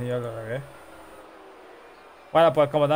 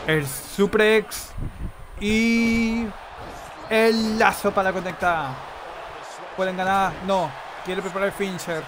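wrestling commentator; 6 semitones higher, slower than usual. Acted. Live wrestling play-by-play.

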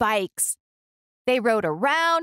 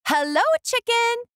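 much higher, bright little duck greeting; cheerful duck voice. A narrator's reading. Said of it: "said Duck".

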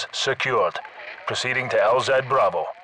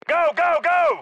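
urgent yell; slower than usual. Acted. Sniper lead urging the squad to move, urgent yelling. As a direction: scream them forward.

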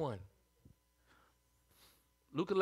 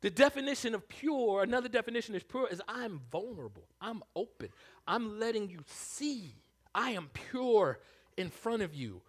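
ordinary talk, nothing marked

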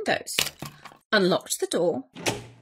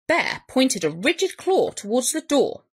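ordinary delivery

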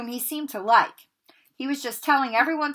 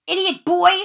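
loud, higher furious snarl; 10 semitones higher, 9 dB louder than usual. Acted. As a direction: snap at the clumsy boy. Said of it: "snarled Snape".

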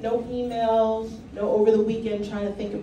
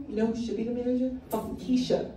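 ordinary talk, nothing marked

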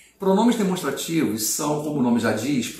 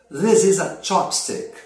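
slow demo sentence; 3 semitones higher, slower than usual. Shown as a demonstration. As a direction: spell it out like a teacher holding up chopsticks. Teacher demonstrating English sentence.

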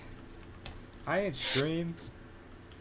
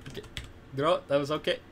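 ordinary talk

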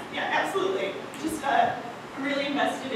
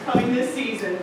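ad announcer; 6 dB louder than usual. Acted. Mock TV ad announcer.

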